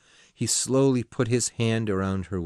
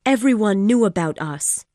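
agitated woman, raised and loud; Missus de Renal, loud and pitched high; 11 semitones higher, 7 dB louder than usual. Acted. Woman reproaching her lover, loud and upset. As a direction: pour it out, upset. Woman character voiced in story.

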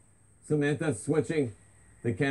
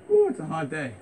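normal talking voice

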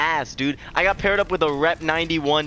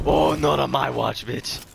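ordinary talk, nothing marked

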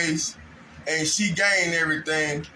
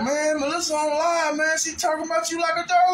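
partner's urgent voice; 10 semitones higher than usual. Retold or imitated. Partner telling him urgently.

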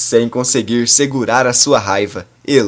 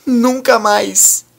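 high anguished wailing, slowing at the end; grieving mother sobbing; 9 semitones higher than usual. A narrator's reading. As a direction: cry out in total despair. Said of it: "chora como jamais chorou".

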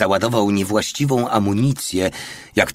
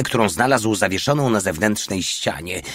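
usual speaking voice

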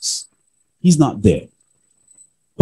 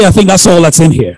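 laughing through the line, a bit louder and quicker; 5 semitones higher, 5 dB louder, faster than usual. Retold or imitated. Wife jokes to husband at the table, laughing. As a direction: giggle it out as a wry joke. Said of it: "She said".

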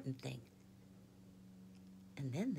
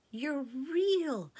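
high loud exclamation; girl crying out in joy, higher and louder; joyful cry; 10 semitones higher, 6 dB louder than usual. A narrator's reading. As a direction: burst out with joy. Susan in joy.

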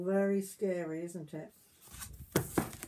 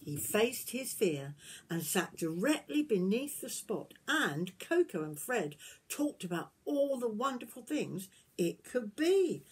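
gentle storybook reading voice; reading to children; 4 semitones higher, 3 dB louder than usual. Acted. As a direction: tell it like a bedtime story to little ones. Reading picture book aloud.

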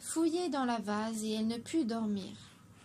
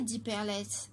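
usual speaking voice

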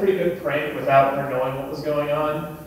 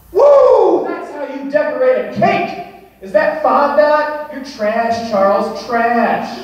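higher, much louder cocky shouting; gloating yell; 8 semitones higher, 7 dB louder, slower than usual. Pretend. Contestant taunting Charles at the cake judging, a loud gloating shout. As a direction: crow over the other bakers like a sore winner.

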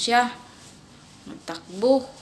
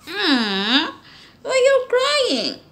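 put-on slow, high baby-talk voice; cutesy slow pet voice; voicing the cat; 12 semitones higher, 8 dB louder, much slower than usual. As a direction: speak as the cat, drawn out.

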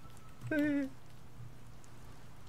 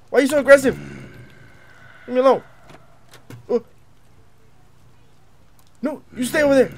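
high, loud frightened pleading; panicked plea; scared pleading; 8 semitones higher, 11 dB louder, faster than usual. Real, not acted. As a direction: beg it to back off, scared. Monster attacks aggressively.

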